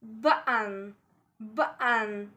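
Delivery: "button" with a catch in place of T; glottal stop. Shown as a demonstration. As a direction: swallow the middle T of "button" in the throat. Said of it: "Again, between two vowels".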